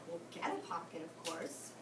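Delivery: sleepy owl. Acted.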